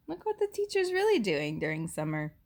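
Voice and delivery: ordinary delivery, nothing marked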